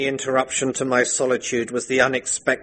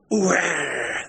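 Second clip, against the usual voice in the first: higher blurted question from the village idiot; idiot Ben; 8 semitones higher than usual. Acted. Ben the idiot asks at the inquest, high and dim. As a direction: blurt it like a simpleton. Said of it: "said Ben the idiot".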